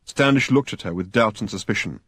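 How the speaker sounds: ordinary delivery, nothing marked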